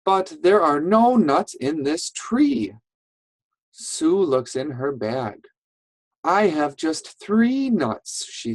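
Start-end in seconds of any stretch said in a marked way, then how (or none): 0.0-2.8 a lightly voiced eager mama squirrel, a bit higher and louder. Teacher reading to kids as Mama Squirrel, lightly voiced. 6.2-8.2 slow, a touch higher, lightly voiced little squirrel; Sue's voice. Teacher reading Sue's line to children, mildly voiced.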